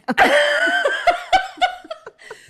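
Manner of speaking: high, loud burst of real laughter; big laugh. Real, not acted. Wife laughing at her own anniversary story. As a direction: break up laughing.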